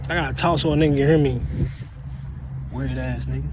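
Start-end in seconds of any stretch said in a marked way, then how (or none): 2.7-3.5 lower, softer aside; quiet low jab; muttered insult. Real, not acted. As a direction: mumble it under breath.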